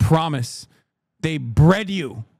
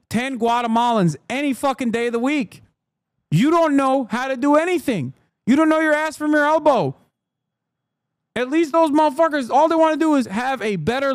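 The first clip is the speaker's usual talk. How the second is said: heated rant; 6 semitones higher than usual. Real, not acted. Ranting at a chatter.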